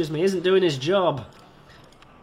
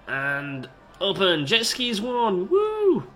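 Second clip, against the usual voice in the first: grand opening cheer; 5 semitones higher, 4 dB louder, slower than usual. Pretend. Opening the new ride.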